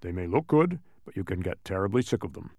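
low advising character voice; Tock. A narrator's reading. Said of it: "advised Tock".

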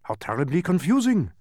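baffled exclamation in character; exclaimed dismay. A narrator's reading. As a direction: cry out, thoroughly baffled. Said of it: "he cried".

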